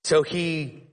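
ordinary talk, nothing marked

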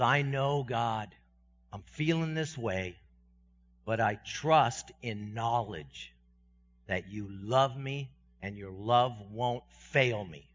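slow, earnest trust; sincere prayer. Acted. David prays to God, slow sincere trust. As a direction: pray it slowly from the heart.

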